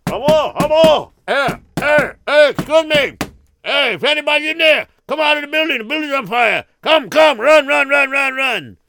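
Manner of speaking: high, loud shouting through a door; calling through door. Acted. Rescuers shout through Esther's door, high and loud. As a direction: bang and yell for anyone inside. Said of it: "and they heard".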